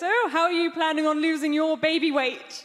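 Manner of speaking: lightly voiced middle-class mum; a hint of a prying fellow mum; antenatal-class mum. Other mum quizzes her at antenatal class, lightly mimicked. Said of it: "one of the other mums goes".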